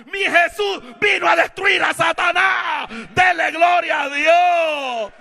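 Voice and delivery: high, loud revival shout; full-force preaching. Real, not acted. Preacher urging church to glorify God, full-force shouting.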